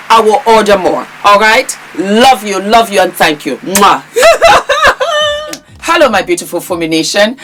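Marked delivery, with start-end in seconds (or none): 4.1-5.6 squealing high-pitched giggle; bright giddy cackle; high laugh. Real, not acted. Laughs after signing off.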